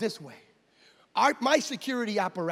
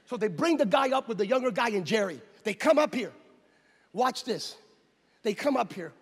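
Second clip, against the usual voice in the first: usual speaking voice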